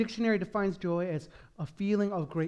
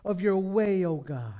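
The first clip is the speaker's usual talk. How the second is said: soft prayerful voice fading lower; 5 semitones lower, 4 dB quieter than usual. Acted. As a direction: pray it low and hushed. Closing prayer.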